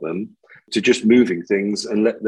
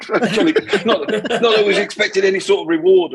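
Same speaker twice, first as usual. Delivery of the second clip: higher, laughing protest; 8 semitones higher than usual. Real, not acted. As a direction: laugh it off as you deny it.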